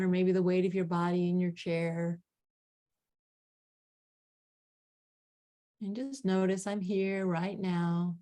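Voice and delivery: calm grounding exercise. Acted. Leads a grounding meditation.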